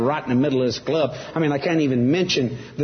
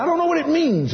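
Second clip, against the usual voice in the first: very high, exclaimed admission; worked-up, pitch leaping up; retold insistent mother; 13 semitones higher than usual. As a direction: imitate mama crying out in earnest. Mom insisting, acted out.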